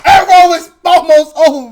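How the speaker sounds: high, loud mock wail; mock anguish. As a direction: wail it like a fan in agony. Dramatic lament over show ending.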